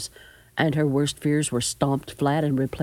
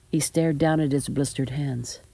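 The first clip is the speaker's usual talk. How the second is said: ordinary delivery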